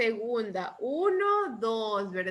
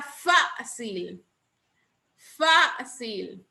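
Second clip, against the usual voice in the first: Fácil with a held, emphasized FÁ; Fácil in two careful syllables; stress on Fácil's first syllable; much slower than usual. Shown as a demonstration. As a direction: lean on FÁ, then let cil fall in Fácil. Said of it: "¿Dónde llevará el acento?".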